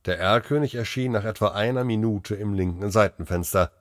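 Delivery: plain reading voice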